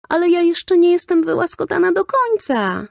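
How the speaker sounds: put-on high, whiny child's protest; protesting little girl. A narrator's reading. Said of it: "zaprotestowała Hania". (wysoki, marudny protest dziewczynki)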